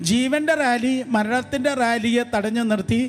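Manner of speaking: fervent revival preaching, rising to the top; full-force preaching. Acted. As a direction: preach it out over the crowd with fire.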